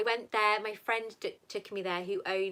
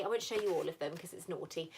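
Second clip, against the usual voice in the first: usual speaking voice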